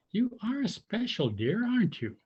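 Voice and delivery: high, fond mother frog. A narrator's reading. Frog mother to Tudley, high and fond. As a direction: say it fondly, lifted.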